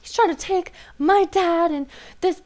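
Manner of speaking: high-pitched indignant complaint; mock an upset daughter, censoring the swearing; high, whiny retelling; imitated upset daughter.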